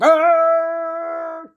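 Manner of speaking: high, loud raider roar; hammed-up raider yell; raider battle cry. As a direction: let out a mock war cry. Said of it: "And they're like".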